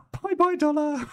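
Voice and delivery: high, giggly mock farewell; waving the dollar off through laughter; laughing. Real, not acted.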